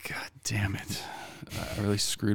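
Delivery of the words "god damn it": whispered curse under breath; frustrated whisper. Real, not acted. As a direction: hiss it to yourself, annoyed. Frustrated aside while forgetting names.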